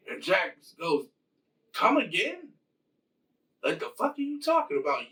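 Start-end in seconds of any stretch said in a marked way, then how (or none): 1.7-5.1 high, fast mock disbelief; incredulous Jax. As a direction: fire it back like an offended tough guy. Jax reacts to Raiden's offer.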